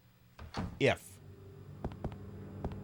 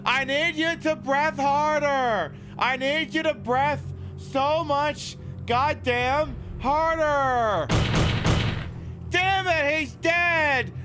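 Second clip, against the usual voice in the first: hammed-up frantic doctor; 9 semitones higher, slower than usual. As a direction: yell at the corpse like a desperate ER doctor. Mock doctor yelling at dead patient.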